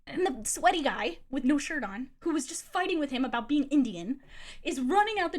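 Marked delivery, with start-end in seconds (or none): none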